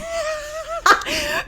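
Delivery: a shrieking burst of laughter; laughing so hard it nearly turns to crying. Real, not acted. Bursts out laughing at own story.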